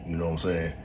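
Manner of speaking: ordinary delivery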